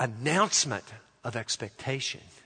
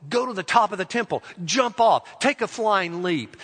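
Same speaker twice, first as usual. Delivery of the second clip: raised, loud tempter's challenge; urging dare; 6 semitones higher, 5 dB louder than usual. Acted. The deceiver tempting Jesus at the temple, urgent daring. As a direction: goad them on with higher, louder commands.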